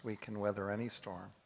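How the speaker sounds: usual speaking voice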